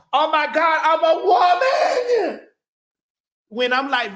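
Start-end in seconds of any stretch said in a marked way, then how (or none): 0.1-2.5 mock victim. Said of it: "falling on".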